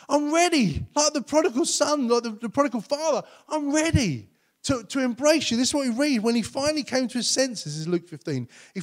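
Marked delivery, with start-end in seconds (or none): 0.1-0.9 higher, laughing as you repeat it; God delighted at the son's return, laughing; laughing welcome. Acted. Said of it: "and God's like". 3.5-5.6 God offering an embrace to the son, tender; tender welcome. Acted. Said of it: "and God's like".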